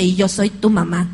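a little boy in a low voice pretending to be mom; little boy playing mom. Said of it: "se saca la botella y me dice". (niño imitando a mamá con voz grave de juego)

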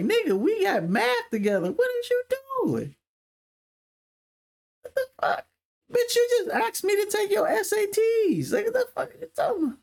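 very high, squeaky exasperation; incredulous protest. Retold or imitated. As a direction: protest in a squeal at a friend who should know you. Retells protesting to his angry homie.

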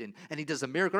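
usual speaking voice

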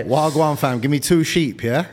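hammed-up street-talking farmer; imagined Yarmouth farmer. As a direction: play a farmer trying out London slang, pushed up and loud. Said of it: "like, some farmer in fucking Yarmouth".

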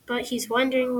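plain reading voice

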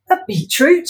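high questioning rise on beetroot; incredulous Wendy. Acted. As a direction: repeat it in disbelief. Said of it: "she repeated".

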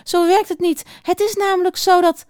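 high-pitched dismissive owl; betweterige uil. Acted. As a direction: put the mouse right. Uil wijst muis betweterig terecht. (hoog en belerend)

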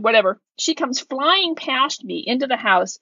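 ordinary delivery

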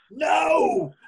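loud high burst of a no; emphatic no. Retold or imitated. Wrestler reassuring Arn he's not upset, loud emphatic no. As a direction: blurt it out.

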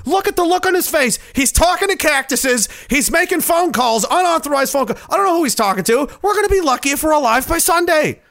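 high, panicky voice that builds; frantic aide. Acted. Aide ranting to the team that the world will end, frantic. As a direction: spiral into alarm.

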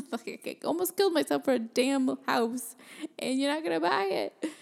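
laughing indignation, loud and high; mock outrage. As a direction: protest in comic disbelief. Indignant at buyer backing out.

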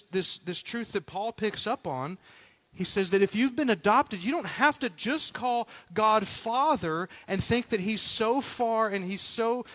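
ordinary talk

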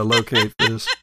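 normal talking voice

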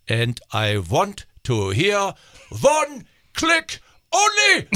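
stern German captain over the intercom, ending in a shout; pilot announcement. Retold or imitated. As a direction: bark the cabin announcement like a strict captain. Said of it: "the captain announces".

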